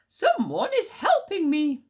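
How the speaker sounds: high, loud, slow slip of voice; wrong character voice. Acted. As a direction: use the stag's high voice by mistake. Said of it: "Oh, wrong voice".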